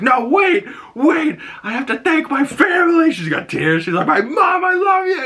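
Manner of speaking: high pleading shout through tears; high tearful shouting. Retold or imitated. Winner begging to thank family, high tearful shout. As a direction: cry out over the playoff music. Imitating Zoe Saldana's tearful speech.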